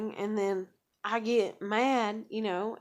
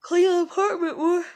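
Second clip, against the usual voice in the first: much higher and louder, squeaky telling; high mock voice; 7 semitones higher, 7 dB louder than usual. To Jen about cleaning, high loud mock voice. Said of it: "I was like".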